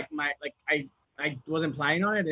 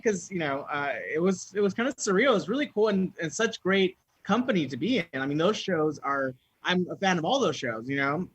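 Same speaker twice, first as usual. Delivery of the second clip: normal talking voice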